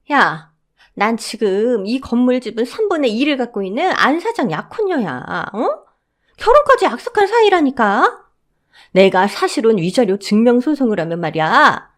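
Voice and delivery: mother-in-law claiming to be the fiancée; indignant claim. Acted. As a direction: insist she was his fiancée.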